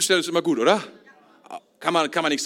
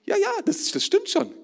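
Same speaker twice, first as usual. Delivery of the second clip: doubting listener; 11 semitones higher than usual. Retold or imitated. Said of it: "Jetzt kommen einige hier".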